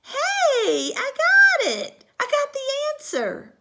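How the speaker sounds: very high, loud burst of joy; light up with the idea; girl who just found the answer; sudden eureka. Acted. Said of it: "whole face got happy".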